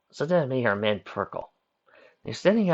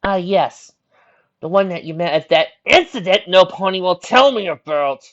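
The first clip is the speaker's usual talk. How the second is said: loud, high, pointed dryness; a little dry; 8 semitones higher, 11 dB louder than usual. A narrator's reading. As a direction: make it a raised, dry complaint. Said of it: "Twilight said a little dryly".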